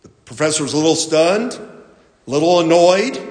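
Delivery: ordinary talk, nothing marked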